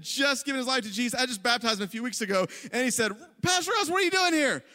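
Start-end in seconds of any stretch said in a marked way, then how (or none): 3.4-4.6 high, cheerful call of surprise; jolly happy guy. Retold or imitated. Happy new convert spotting his pastor, jolly high-pitched greeting. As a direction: hail the pastor brightly, pitched well up. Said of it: "this just jolly, just happy guy".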